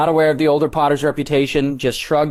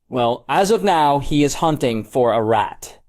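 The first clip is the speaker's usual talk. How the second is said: usual speaking voice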